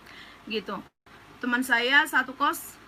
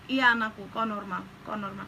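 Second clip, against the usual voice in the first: soft, lowered, fatherly reassurance; imitating a gentle comforter; Tuhan Yesus soothing; 4 semitones lower, 5 dB quieter than usual. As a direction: soothe a child, sinking quieter. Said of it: "Tuhan Yesus belai rambut saya".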